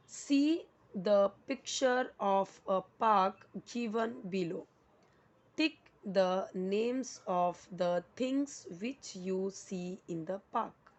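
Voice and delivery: slow, word-by-word reading; deliberate classroom dictation pace; instruction read-aloud. Shown as a demonstration. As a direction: read it out slowly for learners, each word separate. Reads out the exercise instruction.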